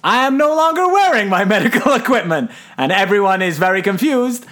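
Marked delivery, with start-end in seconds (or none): 0.0-2.5 laughing through it. Retold or imitated. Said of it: "Tom Hanks goes".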